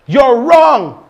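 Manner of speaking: loud, high, curt "You're wrong."; blunt rude retort. Shown as a demonstration. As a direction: snap "You're wrong." at someone. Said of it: "That's not appropriate".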